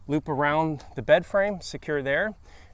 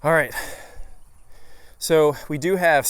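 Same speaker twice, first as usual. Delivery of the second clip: out of breath; 3 dB louder than usual. Real, not acted. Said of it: "sorry, I'm out of breath and it's hot".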